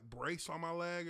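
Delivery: usual speaking voice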